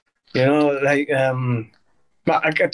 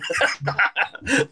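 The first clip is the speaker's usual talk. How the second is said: real laugh; 4 semitones higher than usual. Real, not acted. Laughing at own joke.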